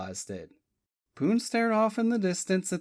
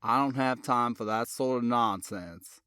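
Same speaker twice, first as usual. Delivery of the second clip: lower, unhurried Virginia Tidewater drawl; 6 semitones lower than usual. A narrator's reading. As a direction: lay on the Tidewater drawl, matter-of-fact. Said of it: "in his slow Tidewater drawl".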